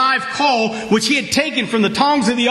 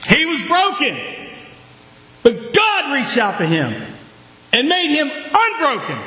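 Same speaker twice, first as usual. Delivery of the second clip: preaching at full force; 6 semitones higher than usual. Acted. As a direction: raise the church up with each line. Preaching climax.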